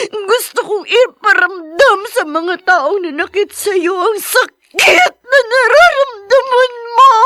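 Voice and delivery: weeping vow of revenge, rising to a wail; crying rage. Acted. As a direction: let the grief swell into a furious cry. Grief turning into rage.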